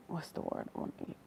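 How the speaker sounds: fast, whispered self-question; hushed, quick search for a word; whispered aside to herself. Real, not acted. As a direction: mutter it to yourself searching for the word.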